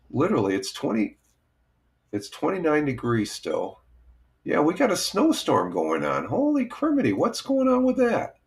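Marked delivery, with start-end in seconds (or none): 6.2-8.3 surprised. Real, not acted. Reacting to snowstorm.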